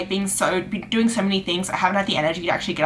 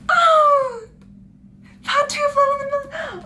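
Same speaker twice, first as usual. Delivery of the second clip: much higher, gasping squeal of joy; delighted squeal; slower than usual. Real, not acted. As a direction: gush over the gift in a high squeal. Delighted at finding a book.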